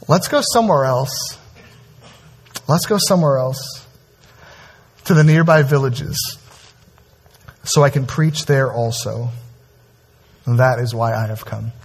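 a hushed, steady resolve; solemn quiet reading. Acted. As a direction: let each phrase settle, hushed and resolute. Jesus's words read solemnly.

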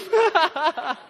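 loud high burst of laughter; real laugh. Real, not acted. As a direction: laugh it out freely. Laughing at own story.

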